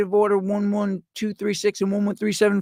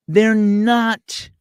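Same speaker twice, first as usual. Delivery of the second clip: ordinary talk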